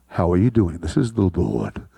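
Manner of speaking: very deep, booming Lord's voice; hammed-up divine bass; God's voice. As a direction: greet them like a rumbling deity. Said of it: "That's how I think God sounds".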